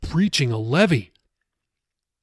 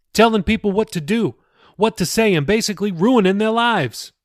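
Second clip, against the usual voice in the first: higher, pressing pastor warning; lightly voiced pastor, insistent; 6 semitones higher, 4 dB louder than usual. Pastor warning Jacob about demons, lightly raised insistent voice. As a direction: push the warning home, a touch higher.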